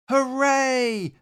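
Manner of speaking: high loud happy hooray; cheer. Acted. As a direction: cheer together for the bear. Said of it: "the rabbits cheered".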